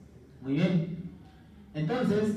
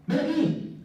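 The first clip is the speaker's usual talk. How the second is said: high, bright, inviting call; eager call; 10 semitones higher, slower than usual. Shown as a demonstration. Teacher demoing a high, inviting "Ven aquí". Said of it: "hay o no hay diferencia".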